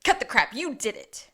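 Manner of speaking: loud, slow, scornful outburst; a loud retold confrontation; mock loud accusation. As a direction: bellow it dismissively. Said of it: "Gus is like".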